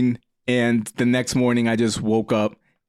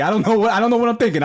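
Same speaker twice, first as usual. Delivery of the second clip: excited; 10 semitones higher, 4 dB louder, much faster than usual. Real, not acted. Drunk story peak, laughing excitement.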